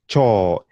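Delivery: "chaw" with an open aw, not oh; Swahili o vowel. Shown as a demonstration. Teacher modelling Swahili word for toilet. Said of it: "Remember, it's not chew".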